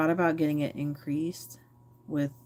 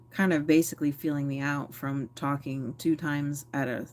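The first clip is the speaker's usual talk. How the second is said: ordinary talk, nothing marked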